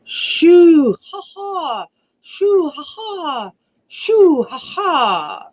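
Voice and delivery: high piercing owl shrieks; screeching owl cry. Acted. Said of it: "cried three times".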